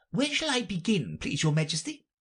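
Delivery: very high, deferential voice; high-pitched plea to the king. Acted. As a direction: interrupt politely as an anxious courtier rabbit. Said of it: "he asked".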